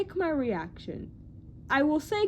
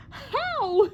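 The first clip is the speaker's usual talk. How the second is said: high, louder incredulous cry; mock bafflement; 10 semitones higher, 5 dB louder than usual. Reacting to a YouTuber's tiny finds, mock baffled. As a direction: burst out in comic disbelief, pitched way up. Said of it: "and I'm like".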